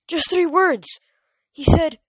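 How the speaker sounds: shaken satyr. Acted. Grover shaken report.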